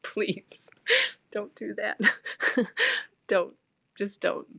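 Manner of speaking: half-laughing, hushed begging; laughing plea. Retold or imitated. Begging ex not to act.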